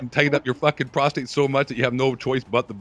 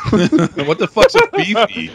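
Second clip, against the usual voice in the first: laughing; 9 semitones higher, 7 dB louder, much slower than usual. Real, not acted. Laughing incredulous question.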